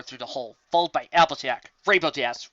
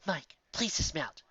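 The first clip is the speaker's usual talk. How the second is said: calm; 2 semitones higher, 8 dB quieter, slower than usual. Acted. Calm royal instruction.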